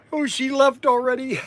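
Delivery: ordinary talk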